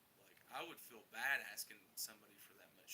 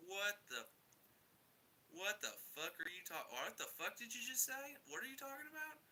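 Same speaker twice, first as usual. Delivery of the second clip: high, rising disbelief; incredulous; 10 semitones higher, 4 dB louder than usual. Retold or imitated. Car owner reacting to friend's account of the damage, incredulous. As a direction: demand an explanation, voice climbing ever higher.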